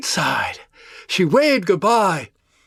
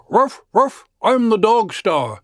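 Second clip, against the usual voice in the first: mock dog bark; 6 semitones higher, slower than usual. A narrator's reading. Jack in foil costume at the door, playful mock barking.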